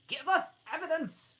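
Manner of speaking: high, loud, commanding king; shrill king voice. Acted. King demanding evidence from the witness, high and loud. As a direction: bark it down from the throne. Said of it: "said the king".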